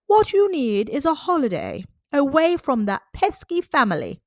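a new doctor addressing Doctor Dog; the doctor's voice. Acted. Dog's own doctor speaks.

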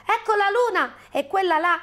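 high, excited cries building to alarm; agitated high-pitched bear. Acted. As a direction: shout out sightings, then urgent warnings. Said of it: "tutto eccitato".

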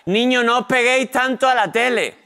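imitating mum calling out a warning; nagging mother. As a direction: scold the kids like a fed-up mum. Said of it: "La frase que más decía mi madre".

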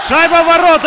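very high, loud goal yell; excited hockey goal call. Real, not acted. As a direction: shout the goal to the arena. Commentator calls the goal.

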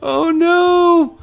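high, loud real panic; panicked no. Real, not acted. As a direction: shriek as the sled slips away. Sled slides away, panic.

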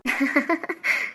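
soft, high little laugh; delighted chuckle. Acted. Father looking at the baby's face, soft chuckle.

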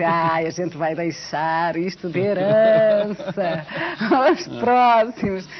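slow, laughing mock of the officials; laughing quote. Retelling the old TV directors leaving the project, laughing. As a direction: chuckle through the bosses' parting words. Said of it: "Disseram assim".

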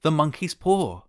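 high, frantic exclamation; wild cry. A narrator's reading. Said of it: "she cried wildly".

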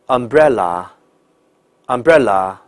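umbrella said slowly with no r-curl; slow careful umbrella, tongue flat; umbrella, British-style, no rhotic r; correct umbrella. Shown as a demonstration. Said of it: "所以这个词应该叫".

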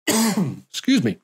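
usual speaking voice

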